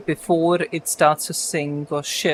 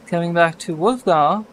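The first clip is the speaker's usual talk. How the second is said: ordinary talk, nothing marked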